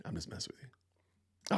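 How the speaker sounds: soft whispered aside. Real, not acted. Streamer whispering to Phil he is joking. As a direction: breathe it, just joking.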